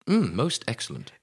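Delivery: soft posh British murmur; posh British accent. Shown as a demonstration. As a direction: savour it quietly in posh British. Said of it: "Say that in a posh British accent".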